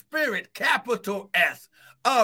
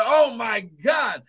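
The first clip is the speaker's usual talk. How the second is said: loud, high, shouted preaching, building to a cry; full force preaching; 3 semitones higher, 3 dB louder than usual. Acted. Pastor shouting the gospel to his congregation, impassioned full force. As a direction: preach it out with everything you've got.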